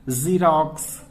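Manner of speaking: slow "ज़ीरॉक्स" with a buzzing first z; buzzing fricative. Shown as a demonstration. Teacher giving students a fricative example, buzzed z in "ज़ीरॉक्स". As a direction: draw out the buzzing z opening "ज़ीरॉक्स". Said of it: "जहां पे buzzing sound आती है".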